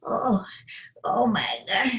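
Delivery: a hint of a tired old lady groaning through her back pain; partly whispered complaints of a sore old woman; aching old lady. Shown as a demonstration. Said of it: "as an older lady".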